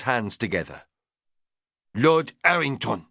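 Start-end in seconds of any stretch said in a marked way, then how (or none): 1.9-3.1 put-on strong French accent, chef addressing his lord; Didier's heavy French accent. French chef greeting Lord Errington, strong French accent. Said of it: "he said in a strong French accent".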